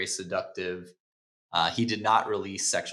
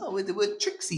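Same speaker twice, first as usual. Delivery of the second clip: "Oh, with, with Trixie" with each phrase ending in a rising, higher questioning lilt; upward inflection; 11 semitones higher than usual. Shown as a demonstration. Coach demonstrating the weak upward inflection to a student. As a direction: end "Trixie" on an upswing as if asking. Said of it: "ending his sentences with an upward inflection".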